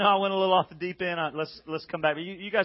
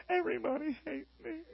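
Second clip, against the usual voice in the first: mock self-pity; 8 semitones higher, 8 dB quieter than usual. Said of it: "I will not lay there going".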